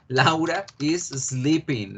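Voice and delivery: slow, spaced-out example sentence; slow model repetition. Shown as a demonstration. Teacher repeats Laura's activity for the class, slow model repetition. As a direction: say it slowly for language learners.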